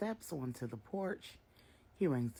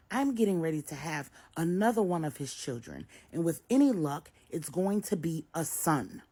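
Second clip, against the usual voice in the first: louder, insistent; defiant daughter; 4 semitones higher, 7 dB louder than usual. A narrator's reading. Jessica insists to her mother she's building a family. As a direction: stand your ground.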